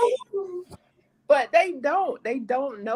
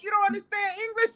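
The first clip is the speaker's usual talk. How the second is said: high-pitched mock indignation; retold aggravated question; 11 semitones higher than usual. Aggravated man to a confused listener, mock indignant. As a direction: imitate an exasperated man, pitched up. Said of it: "He was so mad at me".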